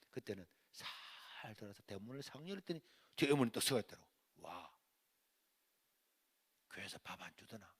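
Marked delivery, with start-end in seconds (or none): 4.4-7.7 near-whispered imitation of mother; hushed, low needling question; low menacing quiet. Mother catching son sneaking home, low and menacing.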